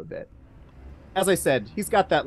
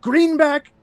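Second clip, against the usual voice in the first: loud, higher enraged accusation; furious mayor; 8 semitones higher, 9 dB louder than usual. Acted. Mayor accusing Greenback before the room, loud rage. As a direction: roar it at the hidden villain.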